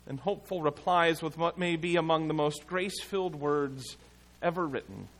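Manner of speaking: normal talking voice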